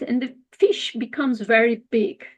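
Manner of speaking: ordinary delivery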